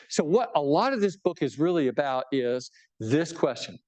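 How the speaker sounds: ordinary delivery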